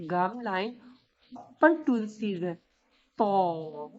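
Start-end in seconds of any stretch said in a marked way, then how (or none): 3.2-3.9 TO lower, drawn out with a heavy rounded vowel; heavy sound. Shown as a demonstration. Teacher shows the articulation point, heavy sound. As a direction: say a long, heavy "To". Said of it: "with a heavy sound".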